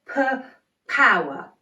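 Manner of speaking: punchy p, p, then power; stuttered p, p, power. Shown as a demonstration. Teacher to kids, punchy p, p, power. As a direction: pop the p sounds before power. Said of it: "crisscross in front of your face on p".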